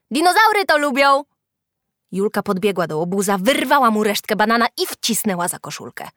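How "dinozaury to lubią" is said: high, loud jeer; taunting shout. A narrator's reading. Freckled boy mocks Julka with banana peels, shouting. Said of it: "krzyknął".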